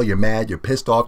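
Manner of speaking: ordinary talk, nothing marked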